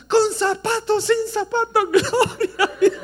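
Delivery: put-on high, loud laughing cry of an old woman; old lady shouting. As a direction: yell it with all your soul, laughing. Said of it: "gritó con todo el alma". (viejita gritando aguda y fuerte entre risas)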